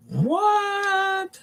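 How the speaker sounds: very high, drawn-out "what?"; high-pitched, incredulous what; baffled disbelief. Real, not acted. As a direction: squeal it in drawn-out confusion. Baffled by a chat message.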